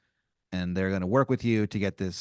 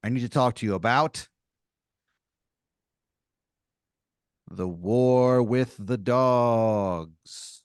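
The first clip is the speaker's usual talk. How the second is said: ominous cat leader; 3 dB louder, much slower than usual. Acted. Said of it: "first he goes".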